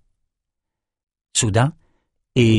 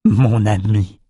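sobbing; slower than usual. A narrator's reading. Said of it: "sanglotait-il".

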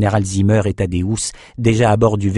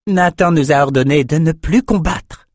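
higher voice, a touch of disgust; disgusted complaint; 7 semitones higher than usual. A narrator's reading. Thorne reporting Nathan's order not to fight, disgusted. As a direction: report the order with displeasure, pitched up. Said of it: "l'air dégoûté".

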